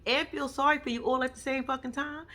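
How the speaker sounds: usual speaking voice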